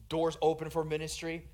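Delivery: usual speaking voice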